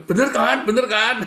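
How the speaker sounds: high, loud, repeated pleading; seeking self-justification. Shown as a demonstration. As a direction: push hard for a yes, twice over. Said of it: "kamu sedang mencari pembenaran". (mendesak minta dibenarkan)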